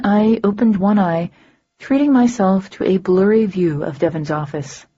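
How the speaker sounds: plain reading voice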